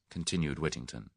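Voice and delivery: usual speaking voice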